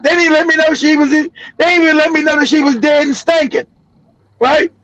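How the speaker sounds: normal talking voice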